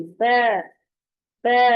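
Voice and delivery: बै said with lips spread as in a smile; Arabic ba, smiling lips. Shown as a demonstration. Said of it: "होंठों को smile करना है".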